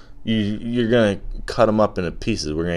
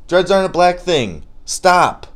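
emphatic; 10 semitones higher, 6 dB louder than usual. Real, not acted. Emphatic rant correcting people.

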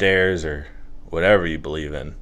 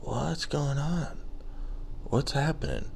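hushed bewilderment; 6 semitones higher, 12 dB quieter than usual. Retold or imitated. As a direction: ask it timidly, very softly. Acts out walking in confused.

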